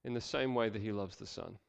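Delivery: normal talking voice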